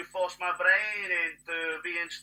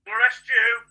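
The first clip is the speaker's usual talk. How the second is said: indignant loud question; 4 semitones higher, 8 dB louder, slower than usual. Real, not acted. Ranting at the authorities.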